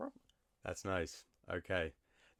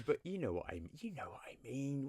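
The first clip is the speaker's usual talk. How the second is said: whispering; 4 semitones higher than usual. Real, not acted. Knowing aside.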